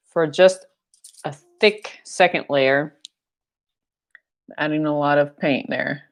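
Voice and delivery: ordinary talk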